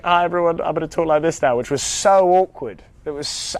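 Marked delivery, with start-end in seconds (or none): 0.0-1.6 put-on American accent, pitched higher. Actor telling everyone he will talk like this, American accent. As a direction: announce yourself in a self-conscious American accent. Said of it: "I was talking American".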